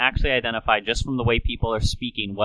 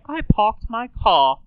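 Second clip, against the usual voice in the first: put-on high, slow British accent, R's dropped; fancy British royal accent; Queen Elizabeth; 9 semitones higher, slower than usual. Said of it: "Queen Elizabeth doesn't say".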